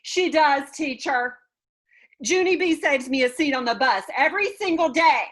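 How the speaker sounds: high, loud call back; shouting back. A narrator's reading. Grace answering across the classroom, loud high shout. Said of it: "That Grace shouted back".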